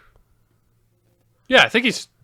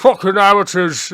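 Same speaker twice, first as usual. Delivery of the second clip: put-on British accent; 4 semitones higher, 5 dB louder than usual. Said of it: "what did he say?".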